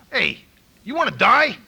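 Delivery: loud, high threatening yell; menacing yell. Retold or imitated. Sponsor yelling at him on the phone, loud menace.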